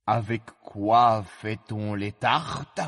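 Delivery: slow, hollow king's questioning; cavernous voice. Acted. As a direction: draw it out in a cavernous voice. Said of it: "d'une voix caverneuse". (voix caverneuse, lente)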